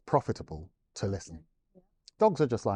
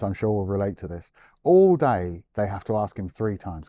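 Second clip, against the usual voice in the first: ordinary talk, nothing marked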